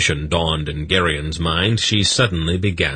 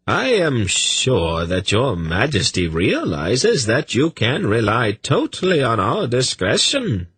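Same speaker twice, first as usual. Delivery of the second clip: smooth; 6 semitones higher than usual. A narrator's reading. Tzadi assuring the king of their discretion, smoothly. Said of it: "Tzadi said smoothly".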